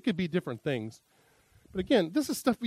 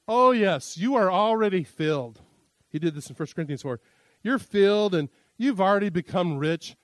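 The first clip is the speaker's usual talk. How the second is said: high, loud mock praise, dripping sarcasm; sarcastic Paul; 4 semitones higher, 5 dB louder than usual. Paul mocks the Corinthians, loud high sarcasm. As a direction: lay on the snark like Paul. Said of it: "his sarcastic tone".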